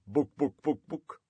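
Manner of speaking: clucking chicken voice; words turned into hen clucks. Acted. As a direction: cluck the words in short, high bursts. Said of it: "joke about a chicken who went into a library and said".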